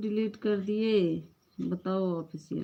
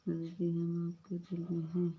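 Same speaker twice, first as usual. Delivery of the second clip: ordinary delivery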